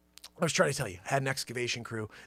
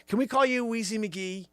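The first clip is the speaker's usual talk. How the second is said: ordinary talk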